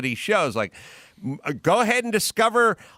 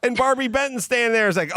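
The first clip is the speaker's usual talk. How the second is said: ordinary delivery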